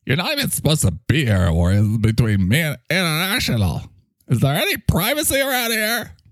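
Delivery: lightly voiced peeved little sidekick; indignant, then grudgingly resigned character voice; annoyed microphone character. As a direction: grumble at the intruder in character. Mike objects to Ori butting in.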